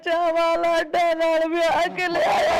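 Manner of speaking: tearful wail. Acted. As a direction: cry out as if ruined. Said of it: "ਰੋ ਨਾ ਮੇਰੀ ਧੀ ਰਾਣੀ". (ਰੋਂਦਿਆਂ ਵੈਣ ਪਾਉਣਾ)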